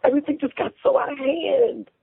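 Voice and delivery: high-pitched pleading whine; whining. A narrator's reading. Wife excusing her lie to her husband, whining. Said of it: "Emma Jane whined".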